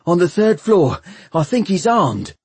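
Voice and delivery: high, urgent, breathless report; panted alarm. A narrator's reading. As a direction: raise the alarm after running. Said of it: "panted Bevis".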